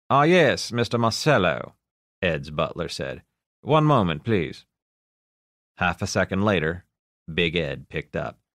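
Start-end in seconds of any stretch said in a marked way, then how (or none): 0.1-1.8 put-on English butler accent. A narrator's reading. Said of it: "The butler with the English accent". 3.6-4.6 put-on English butler accent. A narrator's reading. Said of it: "The butler with the English accent".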